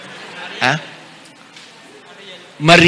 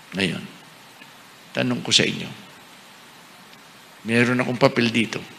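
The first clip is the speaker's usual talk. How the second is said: usual speaking voice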